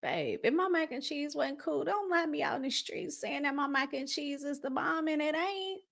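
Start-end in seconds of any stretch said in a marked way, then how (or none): none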